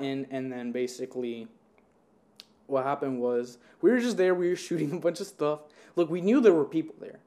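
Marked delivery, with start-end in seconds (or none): none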